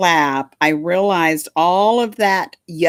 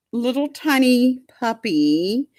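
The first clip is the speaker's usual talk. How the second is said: normal talking voice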